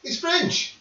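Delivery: high, loud exclaim; excited shout. Real, not acted. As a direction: shout it out, protesting. GM shouting defence, laughing.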